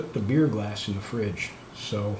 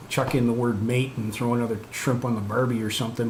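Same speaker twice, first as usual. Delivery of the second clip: ordinary delivery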